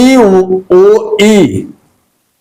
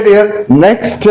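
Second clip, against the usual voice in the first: ordinary talk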